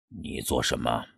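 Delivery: a low, cold question from a long-silent man; strange hoarse voice. Acted. Master catches the student reading his manuscript, cold and low. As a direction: speak as if the voice hasn't been used for weeks. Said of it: "他的嗓音听上去有点怪怪的".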